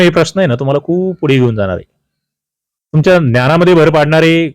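ordinary talk

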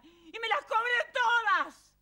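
high, loud, laughing and crying at once; euphoric outburst. Real, not acted. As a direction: pour out years of held-in rage. Relives cathartic shouting, laughing, crying. (desahogo eufórico entre risas y llanto)

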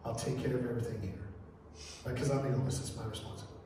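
low, hushed, near-whisper; retold bedside whisper. Son releasing his dying dad, low and hushed. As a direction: softly retell words spoken into a dying father's ear. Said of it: "I whispered in my dad's ear".

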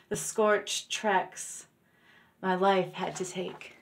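deliberate poem recitation, phrase by phrase; poetry reading. Acted. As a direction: recite with pauses between the lines.

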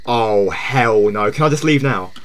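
low, soft, reluctant murmur; subdued dread. Real, not acted. Streamer wanting out of scary game, subdued dread. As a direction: say it low and under your voice.

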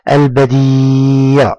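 البديع slowly, with a very long ee; drawn-out madd. Shown as a demonstration. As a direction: hold the ee of البديع steady. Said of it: "مد بالياء". (مدّ الياء طويلًا في البديع)

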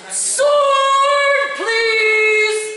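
high, loud bellow into the forest; yelled call. Acted. King bunny yelling for his little bunny. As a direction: call out as if across the whole forest. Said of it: "yelled into the forest".

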